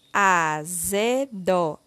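AZEDO drawn out slowly as a, ze, do; syllable by syllable. Shown as a demonstration. Teacher completing the word with syllable zé, slowly syllable by syllable. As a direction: sound out AZEDO one syllable at a time. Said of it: "Iremos utilizar a sílaba zé".

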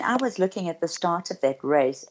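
ordinary talk

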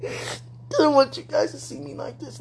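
choking it out through real tears; whispered sob; crying. Real, not acted. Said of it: "don't want you to hear me like this".

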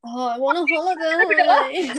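high, slow, whiny wish; whiny longing. Real, not acted. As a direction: moan it out, pining for a beach. Longing for a holiday, playful.

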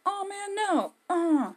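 high, hammed-up wail of panic; mock panicked cells. Good cells facing covid invaders, high mock-panicked wail. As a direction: squeal like a doomed little cell in mock terror. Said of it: "And your good cells are like".

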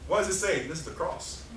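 ordinary delivery

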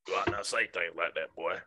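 deep, quiet character reproach; Sling Blade. Acted. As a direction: scold softly, far down in pitch. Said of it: "The sling, and Sling Blade".

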